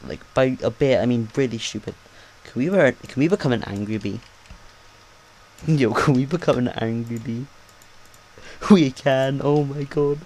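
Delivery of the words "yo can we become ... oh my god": amused, laughing through the words; excited and breaking into laughs. Real, not acted. Gamer discovers he can become an angry bee, laughing.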